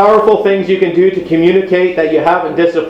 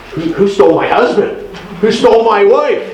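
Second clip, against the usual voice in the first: higher, amazed mock disbelief; mock astonishment; 5 semitones higher than usual. Preacher joking about a partner suddenly this kind, mock astonished. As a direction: act stunned at the change.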